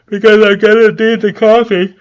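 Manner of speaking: high, loud, yawn-stretched words. Real, not acted. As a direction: talk straight through a yawn. Another yawn while talking.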